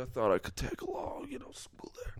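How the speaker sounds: mock hushed whisper. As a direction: whisper it like a sleazy secret. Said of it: "He talked like that?".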